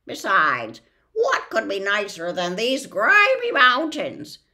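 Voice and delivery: loud, high disdainful bird. Acted. The bird sneers at Context, loud and high. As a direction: scorn your home, praise the grime.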